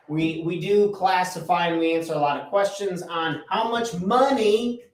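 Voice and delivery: ordinary talk, nothing marked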